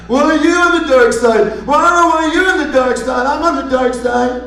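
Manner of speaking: high, whiny put-on villain voice; hammed-up high-pitched petulance; mock bickering villains. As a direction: whine it like squabbling crybaby villains. Mimics villains bickering childishly.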